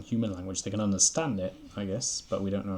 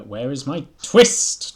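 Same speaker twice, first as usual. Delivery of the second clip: slow, loud, higher demand with "twist" hit hard; louder, slower "where is my twist?" stretching "twist"; capital-letters demand; 5 semitones higher, 15 dB louder, much slower than usual. A narrator's reading. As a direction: draw out "where is my twist" loudly. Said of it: "Twist, in capital letters".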